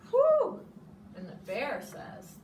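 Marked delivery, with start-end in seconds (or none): 0.1-0.6 loud high whew of relief; tired loud relieved whew. Acted. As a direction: blow out a big whew. Badger after stroll.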